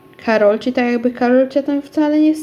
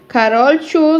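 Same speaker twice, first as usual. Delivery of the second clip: calling out; 5 semitones higher, 5 dB louder, slower than usual. A narrator's reading. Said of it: "zawołała, stojąc na progu".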